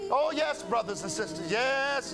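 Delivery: ordinary talk, nothing marked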